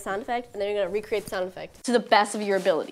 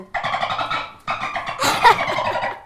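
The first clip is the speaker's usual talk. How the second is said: very high squeaky dolphin call; dolphin squeal; 4 dB louder than usual. Acted. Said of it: "Cue the dolphin".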